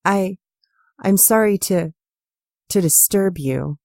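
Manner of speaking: tearful, broken apology; sobbing. Acted. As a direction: choke out the apology through tears. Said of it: "The sound of Anna sobbing".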